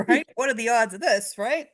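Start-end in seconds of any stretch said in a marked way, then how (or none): none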